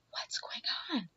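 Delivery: hushed whisper in a bathroom stall. Retold or imitated. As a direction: whisper it so nobody hears. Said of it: "we're whispering because there's people here".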